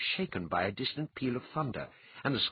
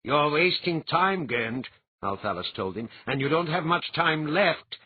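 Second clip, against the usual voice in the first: firm warning; 4 semitones higher, 5 dB louder than usual. A narrator's reading. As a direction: remind the madman time runs out. Althalas taunting Gen.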